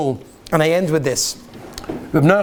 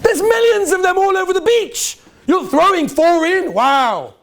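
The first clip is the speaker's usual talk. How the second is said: mocking old man; 10 semitones higher than usual. Old man mocking the boy at the beach, high scoffing. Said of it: "He says".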